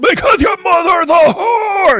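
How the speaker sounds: high, loud, slow parody scream; hammed-up shrieking reply; mock furious yell. Acted exchange, Sam answers.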